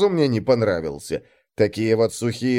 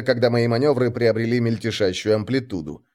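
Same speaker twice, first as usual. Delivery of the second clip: plain reading voice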